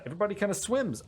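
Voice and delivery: ordinary talk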